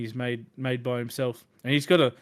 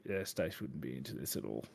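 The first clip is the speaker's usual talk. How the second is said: much lower and much softer, dry and flat; deadpan realization; 10 semitones lower, 12 dB quieter than usual. Retold or imitated. Teasing a friend about a game she'd hate, low dry deadpan. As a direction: drop it low and quiet, a dry aside. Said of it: "I was like".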